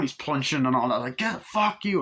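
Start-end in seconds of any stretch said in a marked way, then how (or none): 1.2-2.0 mock angry shout. Retelling a bar fight.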